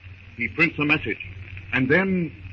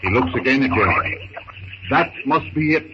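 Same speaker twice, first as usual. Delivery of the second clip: usual speaking voice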